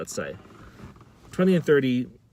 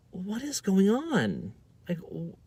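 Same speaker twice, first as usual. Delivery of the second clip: high, slow, incredulous question; baffled; 8 semitones higher, 3 dB quieter, slower than usual. Retold or imitated. Student unable to believe the argument, baffled. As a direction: draw it out in total bewilderment. Acting out own confusion.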